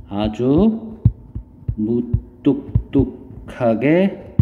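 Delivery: slow phrases with long pauses; writing-along dictation. Real, not acted. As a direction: spell out the thought slowly as you write. Writes answer while saying it. (받아쓰듯 천천히 끊어 말하기)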